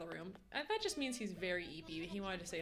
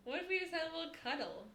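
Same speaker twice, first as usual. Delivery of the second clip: high cooing pet voice; 8 semitones higher than usual. Real, not acted. Streamer offering her cat a cuddle, high baby talk.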